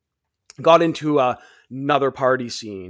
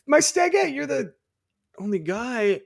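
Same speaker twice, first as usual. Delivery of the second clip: imitated puzzled girl; 9 semitones higher than usual. Birthday girl confused at her stagette, imitated high voice. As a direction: start in falsetto and let it sink.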